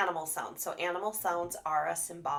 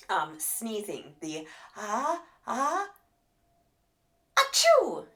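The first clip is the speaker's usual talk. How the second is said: building sneeze; 2 semitones higher, 4 dB louder, slower than usual. Shown as a demonstration. Therapist modelling a sneeze to build a child's excitement. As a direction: build the pause, then burst out the sneeze. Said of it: "you leave that pause and build the excitement".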